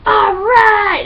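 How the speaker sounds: high, loud mock triumph; sudden high loud cheer; triumphant cheer. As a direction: crow over the finished sandcastle. Proud sandcastle builder cheering.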